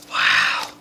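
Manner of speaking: breathless whispered wow; awed whisper. Real, not acted. Reader whispers in awe at the cards. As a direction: whisper it, stunned by the spread.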